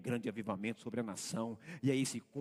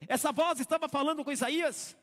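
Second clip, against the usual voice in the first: full-force preaching; 11 dB louder than usual. Acted. Pastor to congregation on servants, fervent full-force preaching. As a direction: preach it out, slowing on each last phrase.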